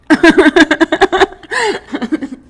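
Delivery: loud high laugh; cracking up; big burst of laughter. Real, not acted. Reacting to awful bar.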